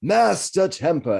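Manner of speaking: high, slow booming call. Acted. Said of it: "a booming voice springs out".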